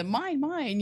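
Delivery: high squawky mine mine like the movie seagulls; greedy seagull squawking mine; seagull cry. Retold or imitated. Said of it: "the seagull in the movie".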